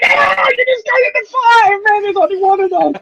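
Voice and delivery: high mock shout at the table; hammed-up outburst over a card; angry yell. Angry player over discarded card.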